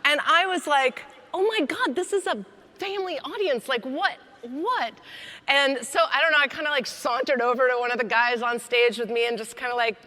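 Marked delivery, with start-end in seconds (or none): 1.3-4.9 high-pitched, flustered disbelief; hammed-up scandalized reaction; mock shock. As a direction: act appalled, pitch jumping up. Retells own shock on stage.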